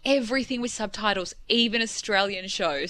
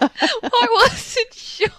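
high-pitched, giggling through the words; can't stop laughing; 13 semitones higher, 6 dB louder, much slower than usual. Real, not acted. Caught pulling a face, giggling.

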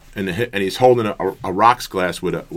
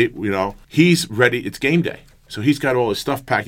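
ordinary talk

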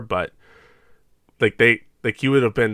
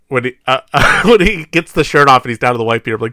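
usual speaking voice

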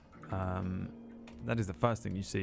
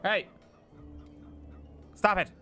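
higher, suspicious townsman voice; suspicious character voice; 7 semitones higher than usual. Acted. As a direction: accuse them of a trick, rising to a shout. NPC accusing party of trickery.

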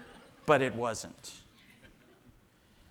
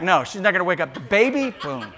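usual speaking voice

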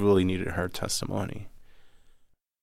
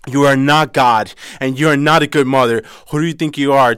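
much louder and higher, outraged; furious shout; 5 semitones higher, 12 dB louder than usual. Retold or imitated. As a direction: scold the mother with fury. Sister confronting the mother.

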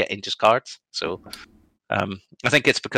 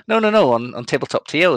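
high, louder string of objections; retold correction; 6 semitones higher, 6 dB louder than usual. Opponent corrects player about his list, retold as high insistent objection. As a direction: cut in to put someone right.

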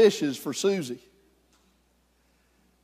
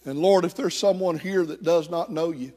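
closing prayer; 2 semitones lower than usual. Acted. Leads closing prayer over music.

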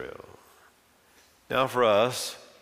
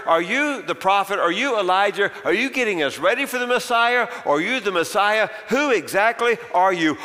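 pressing questions; 5 semitones higher than usual. Acted. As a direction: fire the questions at him, rising. Priests pressing John with questions.

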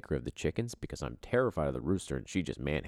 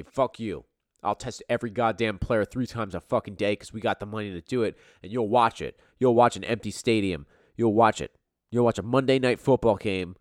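loud, defiant voice of the league; defiant angry rant; 6 semitones higher, 8 dB louder than usual. Retold or imitated. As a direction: bark it like a greedy boss. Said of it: "N F L is gonna say".

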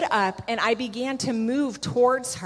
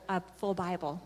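ordinary talk

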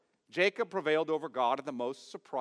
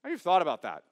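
normal talking voice

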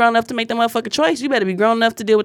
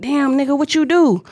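higher, incredulous street voice; mock friends' reaction; 7 semitones higher than usual. Job's friends gawk at his ruin, mock incredulous and higher. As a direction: play the shocked homies.

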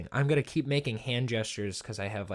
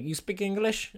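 high-pitched mock Russian question; put-on Russian accent; 9 semitones higher than usual. Russian stranger asking a confused student, high mock Russian accent. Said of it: "what accent I'm doing right now".

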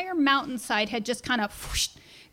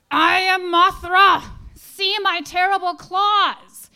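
slow, higher, louder mock monster voice; hammed-up monster; 7 semitones higher, 6 dB louder, slower than usual. Dad in high heels growling at his son, hammed-up monster. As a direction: play a silly monster for a small son. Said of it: "And he says".

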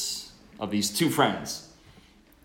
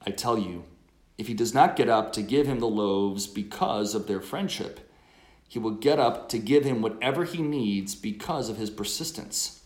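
loud, measured scripture reading; gospel proclamation; 5 dB louder than usual. Acted.

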